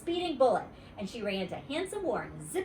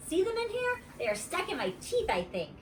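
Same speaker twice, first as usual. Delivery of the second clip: put-on little girl voice; 9 semitones higher than usual. Junie B voice.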